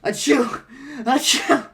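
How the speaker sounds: loud, high acted sneezes; allergic sneezing. Allergic to the cat, loud fake sneezing. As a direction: sneeze the word out explosively. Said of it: "My allergies!".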